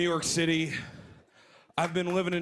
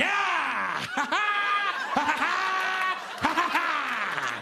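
higher laughing whoops; goofy dance whoops; 9 semitones higher, much slower than usual. Retold or imitated. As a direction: whoop like a horny Fiddler on the Roof dancer. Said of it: "she's just like".

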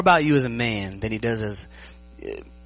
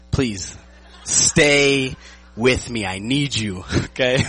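pleading through laughter, stretching the words; laughing plea; 5 dB louder, much slower than usual. Real, not acted. Speaker to congregation after offending old people, laughing plea. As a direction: beg half-jokingly while cracking up.

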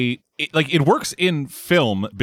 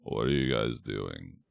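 very low, slow vocal fry mumble; 12 dB quieter, slower than usual. Retold or imitated. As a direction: drag it out low in a creaky fry. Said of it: "low register vocal fry".

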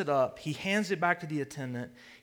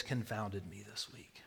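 confiding whisper; hushed confession; 5 semitones lower, 10 dB quieter than usual. Real, not acted. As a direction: let them lean in to hear. Confessing his confusion to the congregation.

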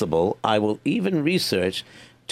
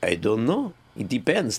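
ordinary talk, nothing marked

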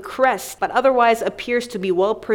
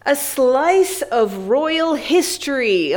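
dramatic headline read; 4 semitones higher, slower than usual. Acted. Begins reading headline dramatically.

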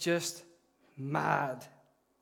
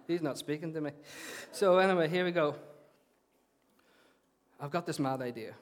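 ordinary delivery

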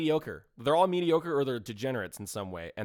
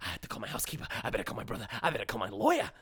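Harvey Fierstein impression; 3 semitones lower, faster than usual. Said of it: "It hurts my fucking throat doing that".

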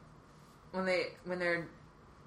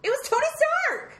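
high, emphatic correction; can't believe you forgot; incredulous; 5 dB louder, faster than usual. Real, not acted.

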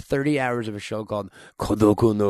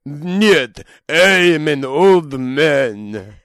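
louder, very slow Russian accent; Russian old man; 2 semitones higher, 7 dB louder, much slower than usual. Shown as a demonstration. Friend asks for a Russian old man, Russian accent.

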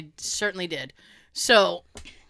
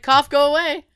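Tony; 6 semitones higher, 7 dB louder than usual. Retold or imitated. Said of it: "He's like".

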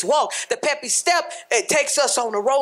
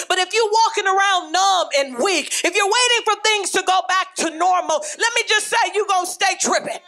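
preaching shout; 6 semitones higher, faster than usual. Real, not acted. Preacher warning the church about staying numb, shouting. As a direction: drive it home at full force. Preacher rising to full force.

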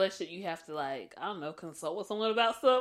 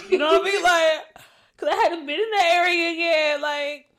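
excited; 11 semitones higher, 15 dB louder than usual. Real, not acted. Excited outburst about moving away.

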